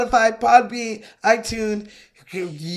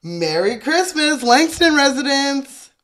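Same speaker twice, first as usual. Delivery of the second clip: high, bouncy phone answer; cheery phone greeting; 6 semitones higher than usual. Acted. Neighbour answering the Langston phone, cheery greeting. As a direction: lilt it up merrily.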